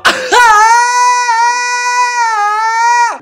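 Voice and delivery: long, very high shriek; piercing loud outburst, falling at the end. Real, not acted. Outburst after crude joke.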